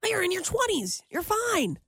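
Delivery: imitating reassuring people. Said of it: "people, they always say that".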